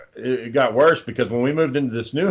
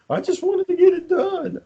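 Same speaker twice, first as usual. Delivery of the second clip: high mock wife voice. Wife's line voiced in story.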